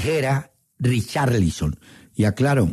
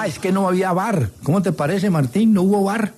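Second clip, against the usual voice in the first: indignant excitement; 7 semitones higher than usual. Real, not acted. Indignant, no VAR.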